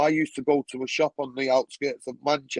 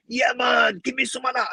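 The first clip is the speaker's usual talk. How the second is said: umbrella seller; 6 semitones higher than usual. Retold or imitated. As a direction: call out eagerly for a share. Umbrella seller asks for some.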